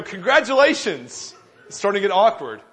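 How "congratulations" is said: loud, slow Congratulations; slow pointed congratulations. Retold or imitated. To the insistent father, a slow, loud Congratulations. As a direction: hold Congratulations out, awkwardly evasive. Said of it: "And I go".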